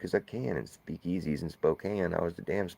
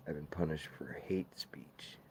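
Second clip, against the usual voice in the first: whispering; 7 dB quieter than usual. Real, not acted. Hushed aside about the ban.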